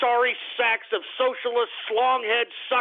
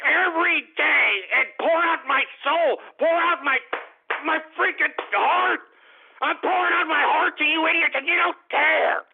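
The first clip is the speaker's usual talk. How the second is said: angry rant; 6 semitones higher than usual. Acted. Radio host yelling at trolls, angry rising rant. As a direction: pour it all out furiously at the listeners.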